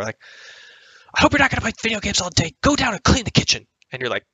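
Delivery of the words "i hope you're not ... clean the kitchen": high, fast nagging mom voice; mom yelling. Retold or imitated. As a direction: nag from down the hall like mom. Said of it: "she yells out the door".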